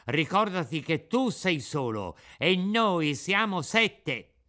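angry schoolboy warning; bullying threat. Acted. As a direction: square up to the puppet.

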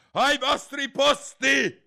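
very loud, slow, high command; thundering queen. A narrator's reading. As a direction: thunder the order across the field. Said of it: "tuonò la regina".

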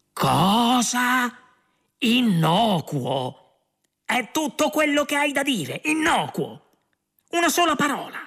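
high, outraged shouting at Ford; furious outburst. Acted. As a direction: hurl it at him in disbelief. Arthur furious at the guide. (Arthur furioso e incredulo, alza la voce con Ford)

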